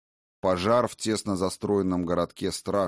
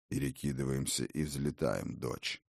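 father's low voice; 6 semitones lower, 8 dB quieter than usual. Acted. Father quietly gives an order.

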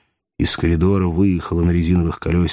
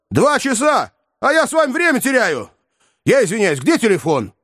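high, urgent agitation; agitated hurry; 13 semitones higher than usual. A narrator's reading. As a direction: rush it, alarmed.

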